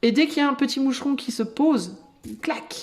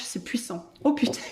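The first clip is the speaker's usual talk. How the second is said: breathless laugh; 5 dB quieter than usual. Real, not acted. Overwhelmed by what she sees.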